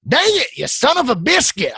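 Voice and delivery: high, exasperated mock curse; mock cursing. Real, not acted. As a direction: cuss yourself out comically. Frustrated, forgot something.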